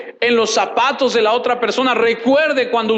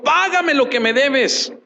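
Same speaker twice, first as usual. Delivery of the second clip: harsh demand; 6 semitones higher than usual. A narrator's reading. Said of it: "lo ahogaba diciendo".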